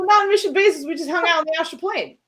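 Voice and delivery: high, loud eager answer; excited. Acted. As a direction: blurt it out brightly. Echoing question, excited.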